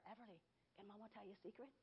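put-on soft, fast hushed question to a baby; secret to baby. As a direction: lean in and coo it softly. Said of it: "I would tell her".

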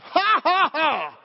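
big, loud, deliberate "ha ha ha"; demonstrated laugh. Shown as a demonstration. Preacher shows how to laugh at symptoms, loud forced laugh. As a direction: laugh out loud on purpose, starting high and forceful. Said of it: "Just laugh".

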